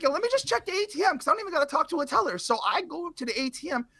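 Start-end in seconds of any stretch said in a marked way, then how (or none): 0.0-2.4 quick, high, breezy self-talk; fast upbeat inner voice; breezy inner thought. Retold or imitated. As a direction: rattle it off, pleased with the shortcut. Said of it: "and I'm like".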